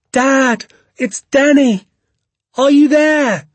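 put-on very high, loud boy's call; shouting for Dad. A narrator's reading. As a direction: holler into the woods for a missing father. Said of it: "I shouted".

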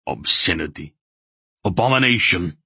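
strangled, appalled condemnation; strangling. A narrator's reading. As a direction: choke out each word in horror. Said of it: "Relg was strangling".

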